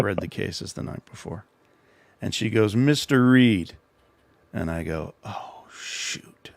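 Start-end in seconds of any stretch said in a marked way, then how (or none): 2.8-3.7 lightly voiced professor, higher and louder; professor calling out. Professor calls on latecomer in class. 5.2-6.5 hushed, voiceless "oh no" moment; dismayed aside. Retold or imitated. Caught unprepared, swears to himself.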